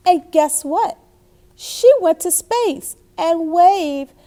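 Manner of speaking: slow, loud, animated read-aloud; excited storyteller savouring the happy ending; triumphant storybook ending. Acted.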